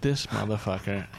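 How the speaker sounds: low, slow disbelief; exasperated aside. Acted. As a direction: say it slowly, fed up. Exasperated aside about friend.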